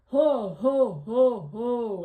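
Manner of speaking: very loud, sliding "ho" calls. Shown as a demonstration. Singer demonstrating larynx exercise, loud hooting ho. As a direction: boom out each drawn-out ho. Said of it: "good way to sort of lower the larynx".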